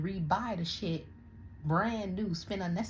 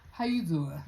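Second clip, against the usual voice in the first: Wendy Williams impression; 3 dB louder than usual. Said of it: "I feel like Wendy Williams".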